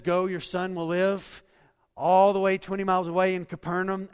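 loud, driving sermon delivery; full-force preaching. Acted. Pastor urging the church to trust, loud preaching. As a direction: preach it out, pushing every line.

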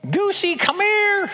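high, slow sing-song call to a little one; a mother calling her little girl to come; mom coaxing toddler. Retold or imitated. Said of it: "And then it was like".

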